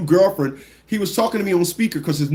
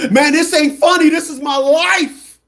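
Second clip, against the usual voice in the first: higher, louder upset whine; indignant whine; 9 semitones higher, 5 dB louder, slower than usual. Retold or imitated. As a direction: protest like your life depends on it. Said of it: "what he responded with".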